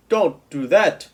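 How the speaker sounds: loud, slow giant's yell. A narrator's reading. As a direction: bellow it in pain. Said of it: "yelled the B F G".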